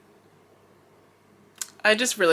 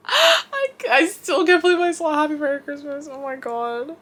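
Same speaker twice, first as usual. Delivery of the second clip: sharp gasp, then a much higher, breathless rush of disbelief; gasping surprise; 10 semitones higher than usual. Real, not acted. Fan remembering the live song, gasping in high-pitched disbelief. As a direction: squeal it out like you can't believe it happened.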